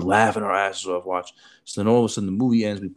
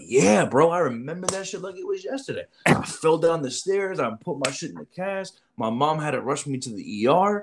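mock lying kid; 8 semitones higher than usual. Kid's lying story, voiced high.

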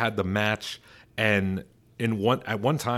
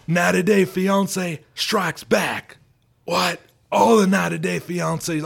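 Stone Cold impression; 9 semitones higher, 6 dB louder than usual. Stone Cold ranting to McMahon on the phone, loud and high. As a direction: yell every line like a rowdy wrestler hollering down the phone. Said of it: "Stone Cold just on the other line".

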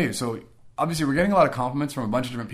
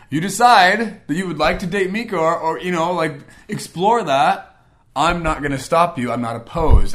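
louder and higher, pushing the words out; loud forced repeat; 7 semitones higher, 11 dB louder, slower than usual. Retold or imitated. Repeating his blessing to his friend, loud and higher. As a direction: say it again, loud, because the friend made him. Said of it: "Make me say it again".

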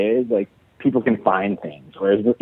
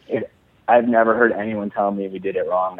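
usual speaking voice

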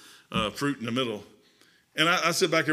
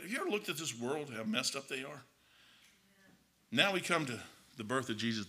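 usual speaking voice

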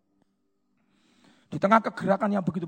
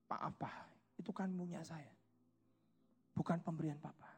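meek reply; 15 dB quieter than usual. Retold or imitated. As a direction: re-enact a hushed, careful defence. Retold meek answer to questioning father. (menirukan jawaban pelan dan rendah kepada ayah)

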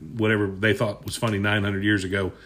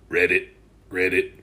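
frog croak; 5 semitones lower than usual. Acted.

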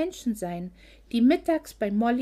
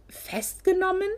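much higher, disbelieving cry; shocked exclamation; 9 semitones higher than usual. A narrator's reading. Friends hear that Logan was arrested, shocked cry. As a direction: blurt it out in shock. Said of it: "riefen Olivia und Isabella gleichzeitig".

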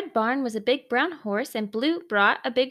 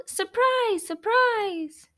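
excited yell; 8 semitones higher, much slower than usual. A narrator's reading. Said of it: "Everyone yelled".

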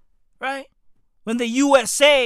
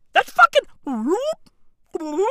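chime imitation; 9 semitones higher, 5 dB louder than usual.

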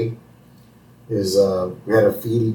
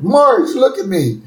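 much higher, louder, desperate Homer; Homer pleading; 10 semitones higher, 3 dB louder than usual. Retold or imitated. Homer begging Marge to trust him again. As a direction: beg her in a high, urgent voice. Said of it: "And Homer's like".

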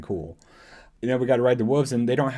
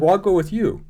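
high, eager little voice; eager offer; 8 semitones higher, 3 dB louder than usual. Retold or imitated. Shin eagerly offering to join Baylan, higher. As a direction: pipe up eagerly to tag along. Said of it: "And she's like".

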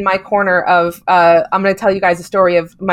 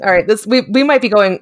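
ordinary talk

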